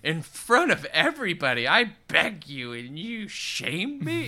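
acted dramatic indignation; vengeful old woman. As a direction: draw it out as a wronged crone's reproach. Voicing the cursing old woman.

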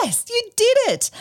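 bright cheering praise; broad singsong kids theatre. Acted. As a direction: cheer them on like a kids show host. Host cheering child's success.

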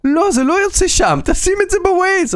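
high, impatient mock retort; exasperated retort. To a lost driver: just put it in Waze, high exasperated voice. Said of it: "הוא אומר לי".

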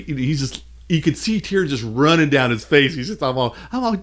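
usual speaking voice

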